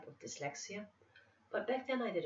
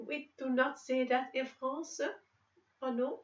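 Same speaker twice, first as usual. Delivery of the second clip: hammed-up French refusal in a raised pitch; French accent; 7 semitones higher than usual. Shown as a demonstration. Explaining French speech to viewers, mock French accent. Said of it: "to do the French accent".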